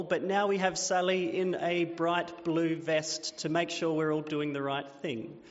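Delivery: usual speaking voice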